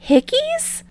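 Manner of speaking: squeaky high-pitched question; high squeak. Acted. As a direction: squeak it out shocked. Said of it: "Deku asks".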